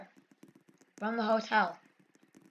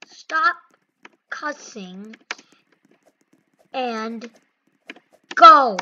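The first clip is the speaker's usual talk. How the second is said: word-by-word order; 3 semitones lower, much slower than usual. Real, not acted. Gamer to cussing player, order given word by word. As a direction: space out every word, then punch go.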